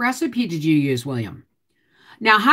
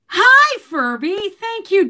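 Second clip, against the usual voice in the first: high, bright hello to the pet; squeaky hello to a pet; cooing pet greeting; 13 semitones higher, 5 dB louder than usual. Real, not acted. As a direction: greet the little pet with delight. Greets her pet Furby.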